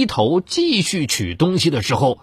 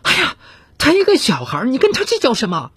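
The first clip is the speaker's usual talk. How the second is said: high, quick voiced mother losing patience; mother getting worked up; flustered; 11 semitones higher than usual. A narrator's reading. Mother defends her little boy to the clerk, flustered and sharp. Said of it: "也急了".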